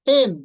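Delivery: "হেন" said as phen, for "pen"; aspirated p opening "হেন"; correct pronunciation. Shown as a demonstration. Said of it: "ফ করতে হয়". ("হেন" ফেন-এর মতো)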